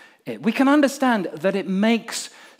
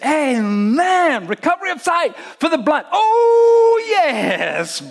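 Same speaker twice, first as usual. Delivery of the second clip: cheering congregation; 4 semitones higher than usual. Retold or imitated. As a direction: whip up the crowd with every line. Crowd reacting to scripture reading.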